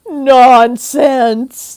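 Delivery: loud firm nonsense; declaring. Acted. Wise aunt dismissing the children's worry, loud. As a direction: brush it aside loudly. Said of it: "declared Aunt Mim".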